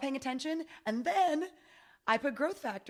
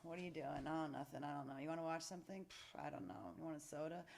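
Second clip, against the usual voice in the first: lazy skin cells chatting; 4 semitones lower, 13 dB quieter than usual. Pretend. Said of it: "They're just like".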